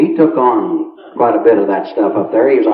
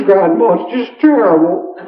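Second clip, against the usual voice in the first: mock crying; 7 semitones higher than usual. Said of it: "he was crying".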